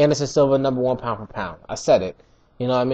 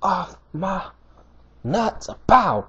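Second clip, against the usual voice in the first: slow quiet taunt; 4 semitones higher, 3 dB quieter, slower than usual. Retold or imitated. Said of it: "He said".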